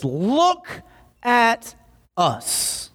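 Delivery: slow, word-by-word, with pauses; measured, high-pitched command to pay attention; deliberate command. Acted. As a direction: draw out each word, starting high. Acting out Peter's command.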